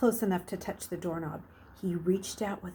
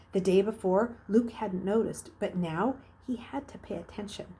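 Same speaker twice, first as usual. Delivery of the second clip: ordinary delivery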